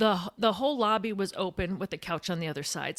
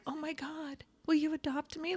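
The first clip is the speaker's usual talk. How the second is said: soft, higher, lightly starstruck plea; awestruck; 6 semitones higher, 7 dB quieter than usual. Retold or imitated. Star holds her hand, softly awestruck reply. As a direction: replay being overcome, starting hushed and high.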